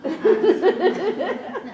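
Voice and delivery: big high burst of laughter; real hearty laugh at own joke; loud laugh. Real, not acted. Laughs at own level one.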